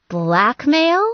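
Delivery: high, loud gasped Blackmail, playfully shocked; teasing fake outrage; mock gasp. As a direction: play-act being scandalised. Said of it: "she mock gasped".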